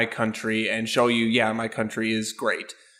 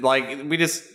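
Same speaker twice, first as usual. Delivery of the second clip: ordinary delivery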